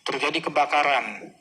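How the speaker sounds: normal talking voice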